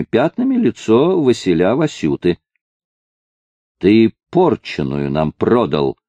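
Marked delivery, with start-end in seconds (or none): none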